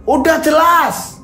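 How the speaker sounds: very high, strained accusing voice; Haji Imron shouting. Acted. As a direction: sneer it as a self-righteous hajj. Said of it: "langsung teriak".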